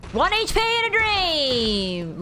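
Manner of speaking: high, drawn-out victory holler; triumphant cry. Real, not acted. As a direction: stretch it out in a soaring cheer. Triumphant cry after surviving in game.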